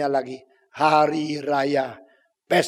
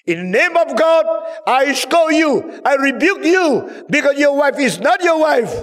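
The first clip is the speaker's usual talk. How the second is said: high, loud thundering English denunciation; John the Baptist rebuking Herod to his face; prophet's rebuke; 11 semitones higher, 8 dB louder than usual. Acted. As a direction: call down judgment on the king. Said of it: "Ini Yohanes Pembaptis".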